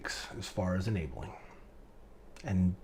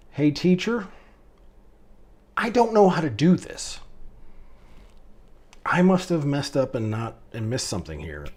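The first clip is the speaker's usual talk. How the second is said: honest student asking for help; 5 semitones higher, 4 dB louder than usual. Pretend. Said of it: "do you say to the teacher".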